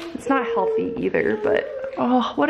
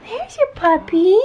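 high, slow sweet voice; 12 semitones higher, 4 dB louder, slower than usual. Real, not acted. Mom to toddler at bedtime.

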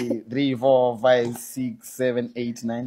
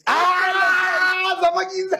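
excited shout; 8 semitones higher, 3 dB quieter, much slower than usual. Real, not acted. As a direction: shout it out in disbelief. Friends shocked at the huge sum.

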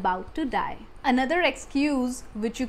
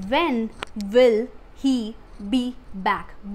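very slow, every word set apart; much slower than usual. Shown as a demonstration. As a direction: dictate it to learners, pausing after each word. Teacher repeats word by word for learners. (एक-एक शब्द अलग करके, बहुत धीरे)

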